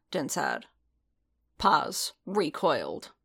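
plain reading voice

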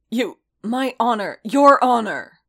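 loud, high, building to a shout; anguished outburst. A narrator's reading. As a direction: burst out, desperate over honor. Beaten fighter protests, refusing to yield.

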